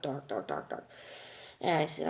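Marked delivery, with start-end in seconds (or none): 0.0-0.9 lower, softer, trailing repetition; fading away. Retold or imitated. Recalling the world going dark before fainting, fading voice. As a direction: let the words dim like fading light. Acts out world slowly darkening.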